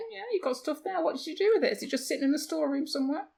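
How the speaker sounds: high, put-on voice echoing the phrase playfully; mock teasing echo. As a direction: tease them by parroting it back up high. Mock echo teasing Disney over props.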